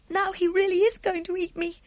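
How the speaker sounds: put-on high, small scared girl voice; a young girl bracing for the worst; frightened little girl. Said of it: "Sophie thought".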